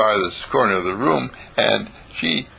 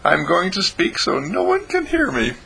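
put-on high-pitched little boy voice; high, secretive kid voice; Howie's child voice; 9 semitones higher than usual. Howie's thought repeated as child.

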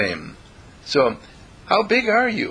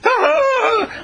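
high piercing fake wail; mock child wail; 5 dB louder than usual. Child runs to mother with a howling wail. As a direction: wail like a child putting on a show. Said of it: "and they say".

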